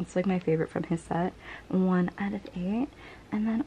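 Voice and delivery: ordinary delivery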